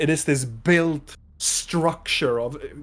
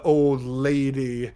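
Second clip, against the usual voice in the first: ordinary talk, nothing marked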